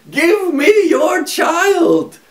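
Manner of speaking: high, slow, loud shout of a little imp; a high imp yells slowly; demanding shout. A narrator's reading. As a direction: bellow the demand for the child. Little man demands the child.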